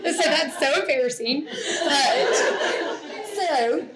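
talking through real laughter, pitch up; laughing while telling it. Real, not acted. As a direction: giggle out the embarrassed words. Laughing at her own embarrassing story.

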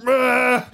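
high mock yell of frustration, "Ah!" thrown out; coach's rage yell. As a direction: yell it like flipping a bench. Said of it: "he gets so fucking mad".

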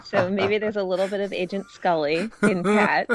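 high, giggly confession; laughing. Real, not acted. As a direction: admit it through a laugh. Laughs while confessing fandom.

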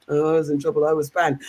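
low, lightly voiced glum kid; banned child. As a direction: answer as a child owning up, subdued. Voices banned child's sulky answer.

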